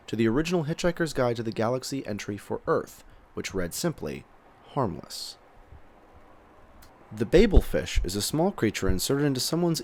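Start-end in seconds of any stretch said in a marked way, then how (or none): none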